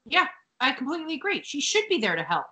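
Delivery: normal talking voice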